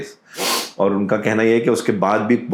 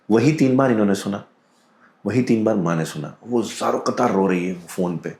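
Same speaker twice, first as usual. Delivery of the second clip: usual speaking voice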